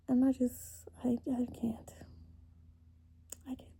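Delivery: choked, whispered through tears; can't get the words out, crying; snotty crying. Real, not acted. Breaking down mid-confession.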